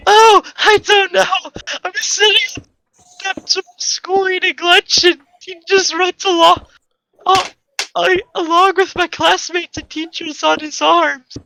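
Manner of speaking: put-on high girl voice near tears; upset girl. Girl explaining to Sherp about the boy, high and upset. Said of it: "She said".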